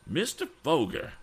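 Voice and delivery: put-on shocked old gentleman exclaiming; crying out. A narrator's reading. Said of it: "cried Mister Damon".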